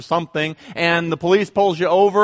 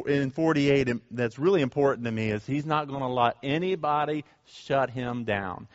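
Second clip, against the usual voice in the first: ordinary delivery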